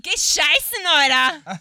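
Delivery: loud, high hammed-up swearing; mock outburst. Joking about answering an Icelander, mock-angry shout. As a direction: shout it like a comic insult.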